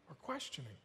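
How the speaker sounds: hushed, low echo of the phrase; quiet repeat. Real, not acted. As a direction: drop it right down as an aside. Repeats the words as quiet aside.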